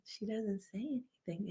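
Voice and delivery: whispered, barely voiced; mimicking her shy friend's whispered voice; imitating quiet friend. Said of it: "she talks like this all the time".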